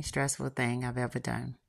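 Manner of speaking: usual speaking voice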